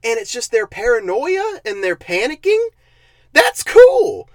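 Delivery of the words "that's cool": sudden high, loud burst of enthusiasm; excited. Real, not acted. Reviewer gushing over the paranoia idea, high loud excitement. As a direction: jump up in pitch, genuinely thrilled. Excited about a missed concept.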